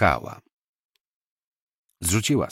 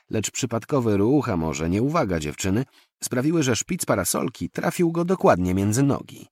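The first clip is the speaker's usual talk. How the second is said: usual speaking voice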